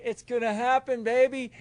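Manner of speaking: hammed-up excited boast; cocky excitement. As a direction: sure you can fly, exultant. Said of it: "I was like".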